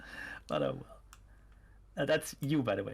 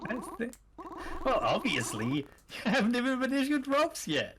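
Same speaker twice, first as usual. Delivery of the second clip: cultist voice; 8 semitones higher than usual. Acted. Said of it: "the cultist voices?".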